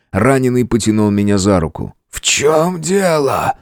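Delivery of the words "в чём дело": slow, high wheezing question; strained. Acted. Wounded man questions his friend, strained. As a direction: labour over every word, pitched up.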